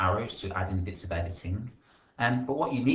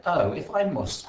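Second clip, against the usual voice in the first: grumpy reluctance; 8 semitones higher, 6 dB louder than usual. Acted. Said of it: "grunted Red, Red angrily".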